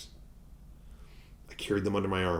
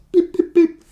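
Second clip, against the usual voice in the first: high, squeaky scanner beeps; mock scanner beep, high; register beeps; 13 dB louder than usual.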